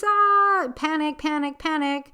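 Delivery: high, loud mock alarm; mock panic. Podcaster opening with a mock panicked cry. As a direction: fake a flustered panic.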